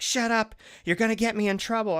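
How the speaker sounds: high, loud flustered scolding; mock scolding owner. Owner in Kohl's scolding her dog, high loud scold. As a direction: hiss it at the dog, panicked.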